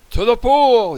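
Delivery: high, loud firemen's shout; yell. A narrator's reading. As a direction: yell it out as a rallying call. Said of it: "yelled the firemen".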